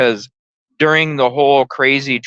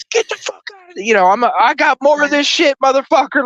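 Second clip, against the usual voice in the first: wild yelling; faster than usual. Retold or imitated. Retelling how he yelled while swerving to scare her. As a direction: yell like a madman to scare her off. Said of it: "I'm scaring the shit out of her".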